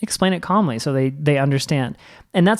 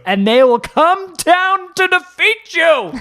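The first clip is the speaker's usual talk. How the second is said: much higher, louder and slow, a hammed-up doom warning; mock ominous; 5 dB louder, slower than usual. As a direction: boom it out like a movie villain joke. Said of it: "I say that jokingly".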